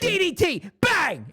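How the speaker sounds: high wrestler catchphrase shout; Diamond Dallas Page. Acted. Wrestler calling out his finisher, high and hyped. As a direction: punch it out like a signature move. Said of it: "Diamond Dallas Page comes out and he goes".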